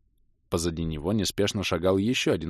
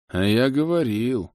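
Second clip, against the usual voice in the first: sad drawl; 4 semitones higher, slower than usual. A narrator's reading. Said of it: "грустно протянул".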